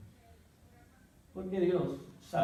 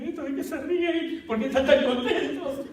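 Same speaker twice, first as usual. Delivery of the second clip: much higher, puzzled and laughing; bewildered; 12 semitones higher, 6 dB louder than usual. Retold or imitated. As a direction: wonder what the fuss is about. His bewildered self.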